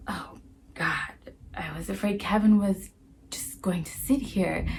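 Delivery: hushed conspiratorial whisper. Acted. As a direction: whisper it so the teacher can't hear. Rhonda whispering in detention.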